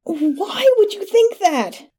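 high, drawn-out moaning question; pained wail. A narrator's reading. Stabbed man questioning his attacker, high anguished moan. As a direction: wail it slowly in pain.

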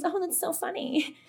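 high, light mock-delighted voice; mock amusement. Retelling early haunting fun to co-hosts, high mock amusement.

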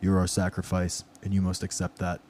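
low goat demon voice; deep voice. Acted. Goat demon telling Austin he is the sacrifice, deep voice. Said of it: "I was trying to do the deep voice".

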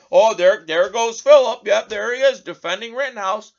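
mock critic. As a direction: jeer like a scornful listener calling him out. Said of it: "You might say".

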